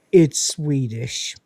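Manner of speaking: slow, drawn-out reading in an odd voice; drawn-out reveal. Retold or imitated. Rimmer reading the label on the crate, slow and drawn-out. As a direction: stretch it out like a let-down. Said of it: "Rimmer goes".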